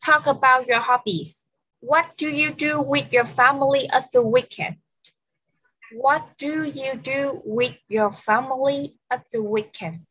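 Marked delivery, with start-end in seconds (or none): 5.9-10.0 slower, word-by-word teacher question; slow repeat. Shown as a demonstration. Teacher repeating the question for an English learner, slowly and word by word. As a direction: space the words out for a language learner.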